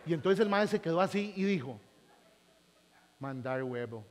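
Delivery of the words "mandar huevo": low gringo mutter. Retold or imitated. Gringo's reaction retold.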